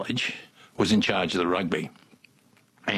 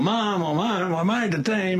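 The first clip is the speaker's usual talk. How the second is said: put-on drunken excitement; 10 semitones higher than usual. Calling to Mum while drunk.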